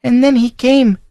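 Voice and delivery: put-on a scared young girl recalls what she saw; frightened girl telling. Girl tells her uncle's household of the stranger, fearfully.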